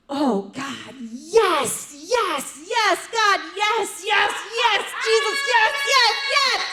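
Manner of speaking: a bird mimicking ecstatic moans, building to a peak; parrot mimicking moans. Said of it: "my darn bird does ... goes".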